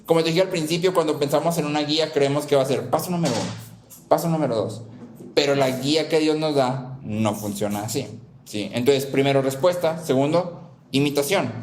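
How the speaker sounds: normal talking voice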